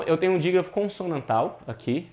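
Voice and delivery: ordinary delivery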